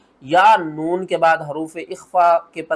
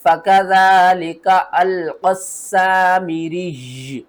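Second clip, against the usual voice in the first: slow, drawn-out tajweed chant; Quran verse recitation; 4 dB louder, much slower than usual. Shown as a demonstration. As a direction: recite slowly and hold the final doubled sound. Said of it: "चलें इस आयत को पढ़ते हैं". (आयत की धीमी तिलावत)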